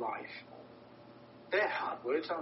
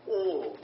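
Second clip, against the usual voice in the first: "all" said louder and drawn out as the key word; emphasis on all; 4 dB louder than usual. Shown as a demonstration. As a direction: lean hard on "all", then pause. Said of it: "I can't emphasize that word enough".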